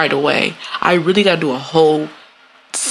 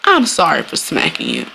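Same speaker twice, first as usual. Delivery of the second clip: ordinary delivery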